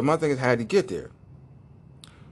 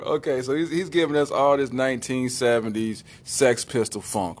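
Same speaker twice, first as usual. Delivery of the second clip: usual speaking voice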